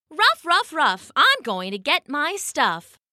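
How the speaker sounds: high yappy dog voice; perky dog chant; barky dog. Acted. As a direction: yap the ruffs like an eager pup.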